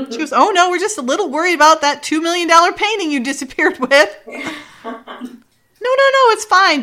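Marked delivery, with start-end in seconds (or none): 0.3-4.1 high, fast sarcastic reply; mock sarcasm. Retold sarcastic rebuke on phone. 4.3-6.8 high, breezy brush-off; play-acted high-pitched reassurance; mock reassurance. Retold breezy brush-off on phone.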